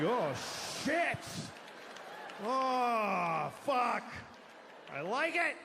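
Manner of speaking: high, breathy excited gasps; aroused exclamations. Acted. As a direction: act turned on, pitch up. Acting turned-on reaction.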